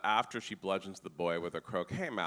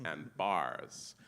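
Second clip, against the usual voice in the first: normal talking voice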